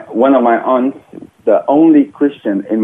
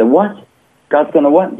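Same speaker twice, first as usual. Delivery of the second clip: surprised disbelief; 6 semitones higher than usual. Retold or imitated. Told God will solve his problem.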